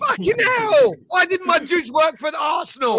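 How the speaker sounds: high-pitched, loud outburst; loud, worked-up protest; exasperated shout. Real, not acted. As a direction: yell it in sheer exasperation. Shouted protest in heated banter.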